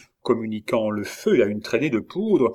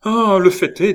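high, exclaimed lamentation; loud lament; 9 semitones higher, 8 dB louder than usual. A narrator's reading. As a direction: burst out in distress. King laments the escape. (lamentation forte et aiguë)

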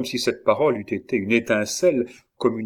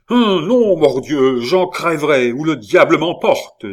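high, loud frantic protest; desperate defiance; 5 semitones higher, 8 dB louder than usual. A narrator's reading. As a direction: cry out in reckless despair. Desperate favourite refuses to be held. (protestation forte et désespérée)

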